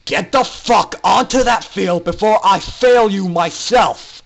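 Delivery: loud, threatening shout; menacing shout. Acted. Instructor shouts menacingly at Orion to get on the field. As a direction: roar it as a final threat. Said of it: "the instructor shouted menacingly".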